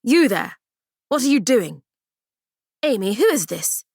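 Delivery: a stern official barking a challenge; clipped, sharp authority. Acted. Official stops the girls' goodbye, stern. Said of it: "A stern voice".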